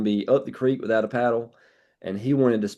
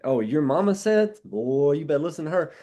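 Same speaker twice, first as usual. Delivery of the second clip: higher, lightly Southern folksy voice; Southern charm voice; 6 semitones higher than usual. Retold or imitated. Seller imitating Southern customers who revere mama, in a higher Southern lilt. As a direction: tease it like a Southern auntie, pitched up. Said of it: "some Southern charm".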